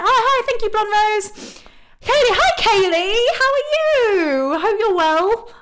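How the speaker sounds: high, bright welcome to chat friends; excited greeting. Real, not acted. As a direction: squeal hello to the viewers by name. Greeting viewers by name in chat.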